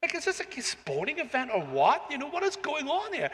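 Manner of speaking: as if you can't believe the pantomime; bewildered thinking. Retold or imitated. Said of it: "And I'm thinking".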